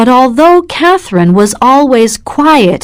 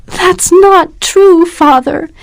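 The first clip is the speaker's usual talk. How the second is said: cried out; 9 semitones higher than usual. A narrator's reading. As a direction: cry out against him, voice lifted high. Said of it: "she cried".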